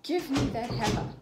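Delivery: loud commanding, fed up; forceful cross order; determined. A narrator's reading. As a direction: grab it with purpose.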